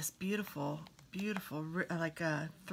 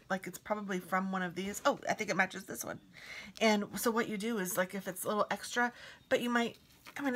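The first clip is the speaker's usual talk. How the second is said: ordinary talk, nothing marked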